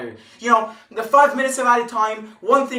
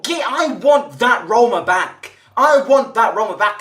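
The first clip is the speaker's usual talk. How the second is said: louder, yelling out his longing; frustrated shout; 2 semitones higher, 6 dB louder than usual. Real, not acted. Fan yells that he wants the old Roma back, loud and frustrated.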